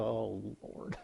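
very low, hushed mock prayer; dreading aside. Pastor hearing a touchy question, hushed dread. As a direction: murmur it privately to God.

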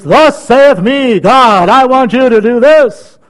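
loud, slow commanding proclamation; God speaking for all to hear; booming God voice. Retold or imitated. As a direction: thunder it like the Almighty. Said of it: "Everybody can tell when God speaks".

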